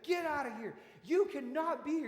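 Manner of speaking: loud, high angry order, retold; mad patrolman. Patrolman clearing cars from under the overpass, mock angry yell. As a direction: imitate an officer yelling people away. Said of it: "he was mad. He was like".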